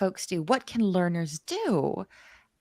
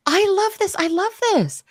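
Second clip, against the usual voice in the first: high, loud, delighted; excited; 11 semitones higher, 6 dB louder than usual. Real, not acted. Host delighted by viewer's career plan, loud and excited. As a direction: gush over the idea. Host reacting to chat comment.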